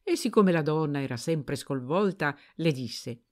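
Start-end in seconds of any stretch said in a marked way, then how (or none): none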